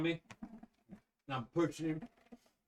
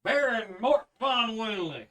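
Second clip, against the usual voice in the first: loud formal herald; 6 semitones higher, 7 dB louder, slower than usual. Acted. Guard presents prisoner to baron.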